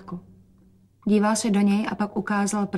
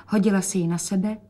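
ordinary delivery, nothing marked

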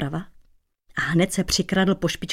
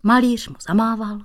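ordinary delivery

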